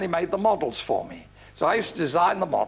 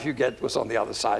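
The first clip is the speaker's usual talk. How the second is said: ordinary delivery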